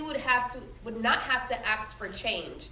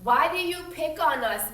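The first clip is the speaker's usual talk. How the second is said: usual speaking voice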